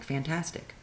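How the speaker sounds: soft, flat-out sarcastic; deadpan sarcasm. Retold or imitated. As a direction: say it dry and quiet.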